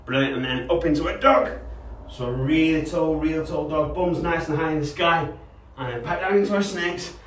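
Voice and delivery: put-on kids' fitness instructor guiding a warm-up; upbeat PE coach cues. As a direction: coach the stretches with energy. Leads dog and snake poses.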